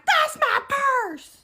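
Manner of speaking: high, drawn-out loud yell; imitated accusing scream. Woman claiming her purse, imitated screaming accusation. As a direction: stretch each word into a shriek.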